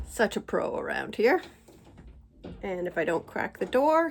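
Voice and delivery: ordinary talk, nothing marked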